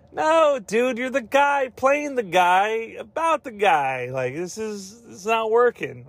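high-pitched mock protest; exasperated objection. Reviewer rejecting a pop star in serious drama, high exasperated protest.

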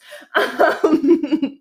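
high, loud giggling laugh; loud giggle. Real, not acted. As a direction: laugh loudly at your own joke.

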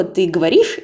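ordinary delivery

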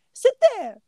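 put-on high, eager kid voice, first word loud; eager little boy. [name] offers Manny a chair in an eager child's voice. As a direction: offer the seat like an excited kid.

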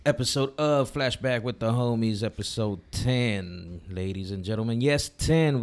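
loud, booming show host intro; grand entrance announcement. Acted.

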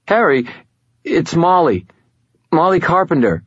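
high, hesitant female caller; Molly Carpenter. A narrator's reading. Young woman identifying herself on the phone, hesitant high voice. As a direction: pitch it up and name yourself haltingly. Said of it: "an odd hesitancy to them".

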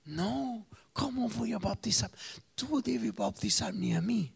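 protesting John. Retold or imitated. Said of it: "Juan discute diciendo".

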